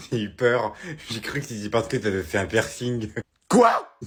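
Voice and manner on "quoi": high, loud startled question; shocked. Acted. Mother catches her son's slip about a piercing, shocked cry. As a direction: snap up in alarm.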